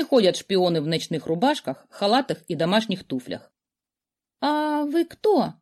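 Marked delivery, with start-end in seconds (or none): 4.4-5.5 high, slow, scared princess; timid girl. A narrator's reading. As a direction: squeeze the words out nervously. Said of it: "выдавила из себя девушка".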